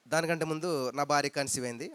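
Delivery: ordinary talk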